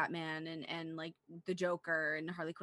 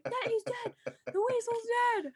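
laughing quote; 5 dB louder than usual. Retold or imitated. Quoting roommate mid-laughter.

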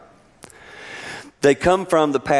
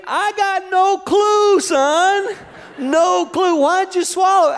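high, slow, drawn-out no clue; dad throwing up his hands at his son; exasperated dad; slower than usual. Retold or imitated. Father answering his son in a high, slow, exasperated voice.

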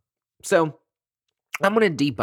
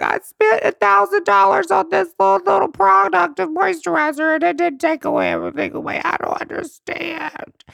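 mock crying; 5 dB louder than usual. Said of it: "then you're just crying".

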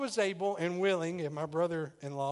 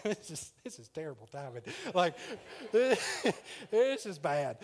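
giggling while saying it's bad timing, last words drawn out; laughing through it; 2 semitones higher, slower than usual. A guy caught by his girlfriend laughing nervously. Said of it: "I instantly start laughing".

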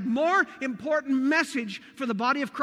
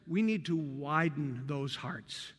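soft plea; 10 semitones lower, 7 dB quieter, slower than usual. Acted. Softly voicing Paul's plea.

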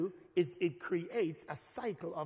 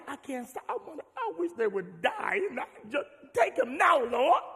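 high, loud mock fury in prayer; angry believer; 8 semitones higher, 9 dB louder than usual. Preacher voicing an angry churchgoer praying in rage. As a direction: vent like you want them gone. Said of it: "you be like".

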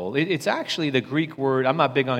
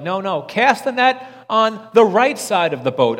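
louder call across the water; mock calling out; 7 semitones higher, 7 dB louder than usual. Disciples voiced shouting over water, lightly hammed-up call. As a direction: call it out like from a boat.

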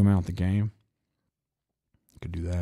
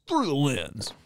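higher, announcer-style title read; mock news anchor; 6 semitones higher than usual. Shown as a demonstration. Podcaster testing a name as a mock news host. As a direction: say it like a news show intro. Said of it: "Sounds like a news podcast".

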